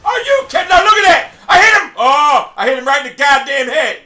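outraged shout. Real, not acted. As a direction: protest at the top of your voice. Shot hit but did not count.

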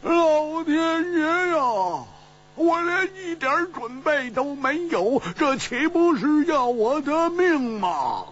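drawn-out, very high panicked wail; wailing outcry. A narrator's reading. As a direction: cry to heaven in comic despair, high and stretched. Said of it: "大呼道".

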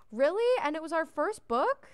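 high, loud, amazed exclaim; delighted surprise. Real, not acted. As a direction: gush with real amazement. Book becomes bestseller.

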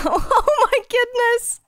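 stunned squeal of disbelief; shocked laughing cry. Real, not acted. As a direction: gasp it out, half laughing. Enemy in full netherite.

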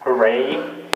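ordinary talk, nothing marked

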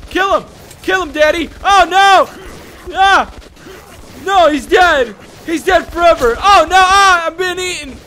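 high, loud hammed-up panic; panicked screaming. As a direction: scream as if monsters are on you. Mock panic as monsters attack.

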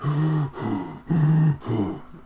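slow heavy masked breath; imitating masked killer breathing; Myers heavy breathing. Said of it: "Why does he breathe like that?".

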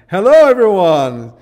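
high, very loud excited greeting; whooping hello to the whole crowd; excited shout. Shown as a demonstration. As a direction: belt out a thrilled hello. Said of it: "Excited voice".

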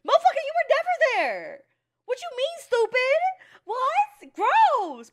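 high, loud outraged yelling; furious yell. Real, not acted. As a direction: scream it at the screen. Yelling back at a character.

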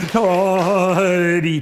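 toddy sung loud and high; teacher singing toddy with tragic weight. Shown as a demonstration. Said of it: "Feel the tragedy".

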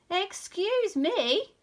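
high, loud, slowly stretched protest; indignant shout. A narrator's reading. Rabbit tugged by Douglas, shouting indignantly. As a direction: shout it out, outraged. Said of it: "shouted the rabbit".

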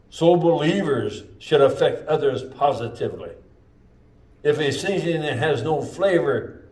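preacher pressing the point to the congregation; full-force preaching. Acted. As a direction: drive it home from the pulpit.

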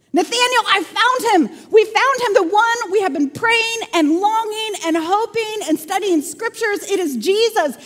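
high-pitched, eager outpouring; excited announcement. Acted. Philip tells Nathanael he found Jesus, thrilled. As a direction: burst in with the great news.

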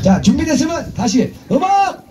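high, drawn-out call for music; stretched, high cry of 음악; calling out cue. Acted. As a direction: sing out the cue to the band. Cueing the band.

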